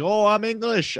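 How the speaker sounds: high-pitched, hammed-up English accent; attempted English accent. Shown as a demonstration. Showing friends his failed impression, mock English accent. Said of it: "when I try and do, like, an English".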